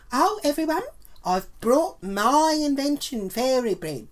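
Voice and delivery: high, showy imitation of Mary Ann; mocking announcement. Mocking Mary Ann announcing to the party, high and showy. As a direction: mimic the smug rival with a high sing-song.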